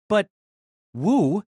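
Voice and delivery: normal talking voice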